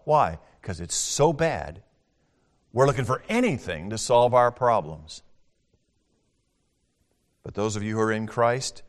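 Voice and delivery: ordinary talk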